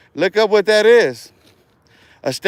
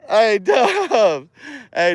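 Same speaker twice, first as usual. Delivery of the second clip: ordinary talk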